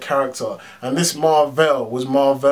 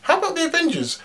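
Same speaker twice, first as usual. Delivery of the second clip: Wasp's line; faster than usual. Retold or imitated. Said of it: "the Wasp was like".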